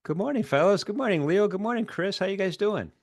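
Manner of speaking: bright, high host greeting; upbeat greeting. Real, not acted. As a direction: greet them with big energy. Host greeting guests on stream.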